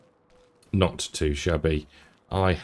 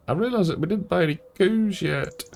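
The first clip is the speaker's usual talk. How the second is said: a hammed-up Scottish accent, pitched high; playful put-on Scottish lilt for the viewers; mock Scottish accent; 10 semitones higher than usual. Farmer jokes to viewers in a mock Scottish accent. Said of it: "I need to stop doing that stupid accent".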